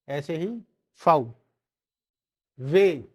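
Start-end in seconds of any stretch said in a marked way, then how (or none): 2.6-3.1 वे said loud; वे as "vay", stressed; German W. Shown as a demonstration. Teacher drills students, loud वे. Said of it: "इसको बोलते हैं वे".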